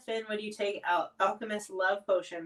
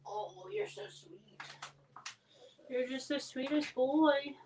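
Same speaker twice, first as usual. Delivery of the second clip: slow, softer doting voice; tender pet talk; 4 semitones higher, 3 dB quieter, slower than usual. Real, not acted. Praising her sweet dog on stream, slow tender doting. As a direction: dote on him slowly.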